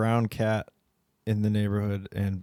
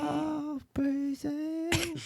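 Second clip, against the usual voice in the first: very slow, very high drawn-out voice; dim-witted cat; 7 dB quieter, much slower than usual. Retold or imitated. Brainless cat answering why mouth hangs open, dim high voice. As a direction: stretch it out as a vacant mouth-breather. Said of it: "He'd be like".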